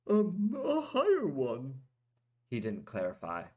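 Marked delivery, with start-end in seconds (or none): 0.1-1.8 high, slow, evasive mumble; sheepish. A narrator's reading. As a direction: dodge the question sheepishly. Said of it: "Goldak sheepishly scratched".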